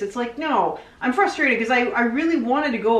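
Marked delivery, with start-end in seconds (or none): none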